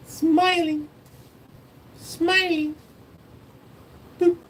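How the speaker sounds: very high, drawn-out singsong; high-pitched cooing. Real, not acted. Owner delighting in his happy dog, high cooing singsong. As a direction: squeak it out slowly to a pet.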